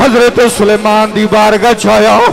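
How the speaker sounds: high, loud impassioned sermon; full-force preaching. Acted. Preacher building to the climax of the story, full-force preaching.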